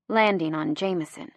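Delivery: plain reading voice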